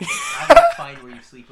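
hammed-up stalker menace, cracking into laughter; mock creepy threat. Cohost jokingly threatening fans who follow him, hammed-up shout.

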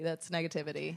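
That's negativity dropped to a low, soft echo. Shown as a demonstration. Co-host echoing That's negativity as a soft low aside. Repeated phrase, second much softer.

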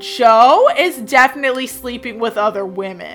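loud mock gossip with punched openings; gossiping witch. Witch telling on a neighbor's husband, emphatic mock gossip. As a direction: drop a scandal like a hammed-up busybody. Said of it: "she also liked to share gossip".

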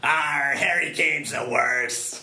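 slow, high pirate cry with a big "Arr". Acted. Pirate co-host gripes about the football, slow hammed-up pirate. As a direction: drag it out like an old sea dog. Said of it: "talking like a pirate now".

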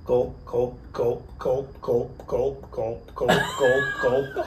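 steady, evenly spaced gulping imitation; imitated chugging. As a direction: mimic someone downing a bottle, beat by beat. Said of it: "he's like this".

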